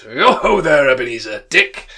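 big, fat, jovial call, loud and high; rich jovial voice. A narrator's reading. Fezziwig calling his apprentices, oily, rich and jovial. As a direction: boom it out like a jolly old master. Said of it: "called out comfortably in a comfortably rich, oily, fat, jovial voice".

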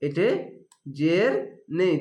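ordinary talk, nothing marked

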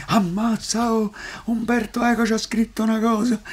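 high, excited Roman gasp; amazed Roman exclamation. Retold or imitated. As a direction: gush in Roman dialect.

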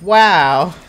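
Dora impression. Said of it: "Dora impression".

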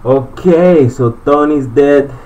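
loud, slow, triumphant; loud, slow victory call; excited announcement. Real, not acted. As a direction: proclaim it loudly and slowly. Boss killed, announces.

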